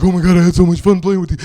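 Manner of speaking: quick, gushing mock gamer; silly voice. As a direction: put on a silly, rushed fan voice. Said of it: "I just do it in a silly voice".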